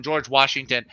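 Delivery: usual speaking voice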